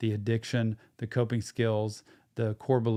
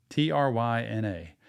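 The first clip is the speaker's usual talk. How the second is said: normal talking voice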